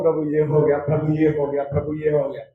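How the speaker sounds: low, soft confession to God; humble quiet prayer. Retold or imitated. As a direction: murmur it meekly, head bowed. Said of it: "प्रभु के सामने जोर जोर से नहीं बोलता".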